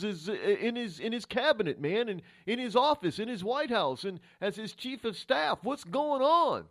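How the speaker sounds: high, loud, indignant complaining; disappointed supporters. Acted. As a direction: imitate outraged voters demanding an explanation. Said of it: "gonna be disappointed 'cause they're gonna think".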